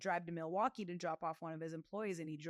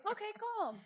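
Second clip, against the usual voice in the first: doubting cousins; 13 semitones higher than usual. Retold or imitated. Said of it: "They're like".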